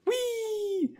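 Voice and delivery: very high gleeful whee; high squeal. Real, not acted. Squealing at a lucky roll.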